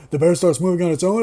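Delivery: ordinary talk, nothing marked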